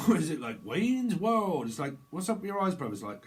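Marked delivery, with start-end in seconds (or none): 0.1-1.7 excited. Acted. 2.1-2.9 mock quote.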